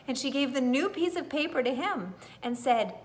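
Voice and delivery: plain reading voice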